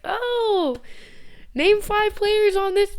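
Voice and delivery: high, slow teasing voice; mock someone testing her; high mocking quizzer; mock quizzing fan. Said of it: "they're like".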